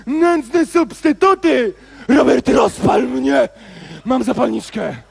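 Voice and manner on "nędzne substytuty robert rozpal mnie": slow theatrical shouted plea; melodramatic plea. Acted. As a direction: beg with overblown passion. Dramatyczne błaganie papierosa. (teatralne, powolne wołanie)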